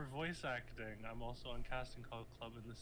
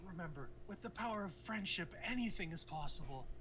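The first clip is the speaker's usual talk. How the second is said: anime voice; 5 semitones higher than usual. Pretend. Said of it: "my anime voice".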